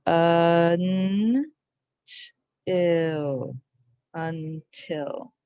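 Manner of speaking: slow un, then till, then until; syllables then whole word. Shown as a demonstration. Teacher reviews the sounds of until. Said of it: "The sounds are".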